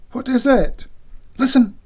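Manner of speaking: high, urgent alarm; alarmed wife. Acted. Siobhan waking McX at night, high alarmed voice. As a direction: rouse him, she's heard something.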